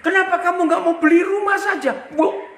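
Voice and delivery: high, loud and fast blurt; words burst out before he can stop them. Retold or imitated. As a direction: pastor blurts a bold suggestion over the phone. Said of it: "Tiba-tiba dari mulut saya itu nyeletuk".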